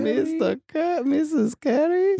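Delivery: high, slow, breathless swooning; swooning fan. Retold or imitated. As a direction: coo the name like a smitten teen. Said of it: "they're like".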